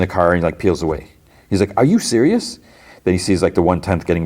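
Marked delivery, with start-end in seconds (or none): 1.8-2.6 high-pitched incredulous question; retold disbelief. Abandoned gang member as the car peels away, retold incredulity. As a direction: act out indignant disbelief, pitch jumping up. Lonnie disbelieves Don's escape.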